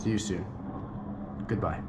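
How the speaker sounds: lightly put-on Transylvanian vampire accent; a hint of a Dracula goodbye. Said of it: "That's, like, my vampire accent".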